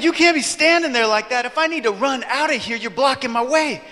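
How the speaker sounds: high, insistent acted complaint; irritated stranger. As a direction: scold him as the annoyed stranger. Acting stranger complaining about blocked way.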